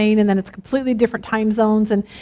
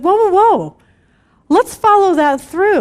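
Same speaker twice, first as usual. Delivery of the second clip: higher, louder whoa whoa whoa to calm her; 7 semitones higher, 4 dB louder than usual. Retold or imitated. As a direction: rein her in, slower on follow that through. Calming panicked daughter.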